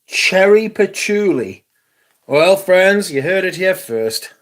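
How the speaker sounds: high, loud, slow showman voice; hammed-up announcer. Presenting a perfume to friends like an announcer. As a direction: announce it like a game-show host.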